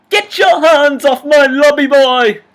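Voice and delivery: very high, very loud indignant cry; shrieking concierge protest; outraged concierge shout. Acted. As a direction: shriek in grand offended outrage. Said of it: "The Grand Budapest Hotel".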